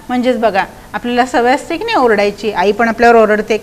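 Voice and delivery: ordinary delivery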